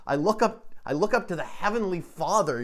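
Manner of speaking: normal talking voice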